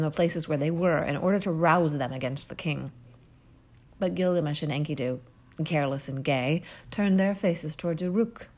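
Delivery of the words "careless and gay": usual speaking voice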